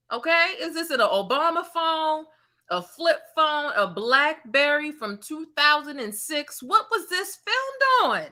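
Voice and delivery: high, slow mock bewilderment; mocking disbelief. Real, not acted. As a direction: drag out the ridicule in a high voice. Mocking the phone camera quality.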